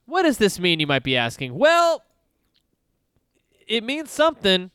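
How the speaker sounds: show host intro. Acted.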